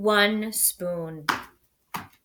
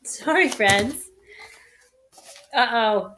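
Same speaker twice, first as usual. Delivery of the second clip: ordinary delivery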